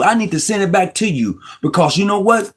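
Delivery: ordinary talk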